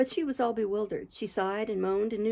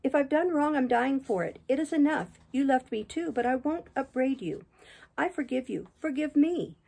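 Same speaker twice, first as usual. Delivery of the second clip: high-pitched, pleading, understated sob; acted anguish in a raised female character voice; sobbing Catherine; 6 semitones higher than usual. A narrator's reading. Dying Catherine asks her lover's forgiveness, in mildly tearful high voice. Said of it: "sobbed Catherine".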